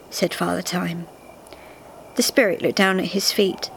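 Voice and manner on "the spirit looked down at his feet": ordinary delivery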